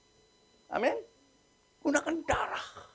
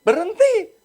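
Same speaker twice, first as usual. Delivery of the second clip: much louder, much higher command; commanding shout; 11 dB louder, slower than usual. Acted. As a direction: stop them with a preacher's cry. Preacher commands the shaman off.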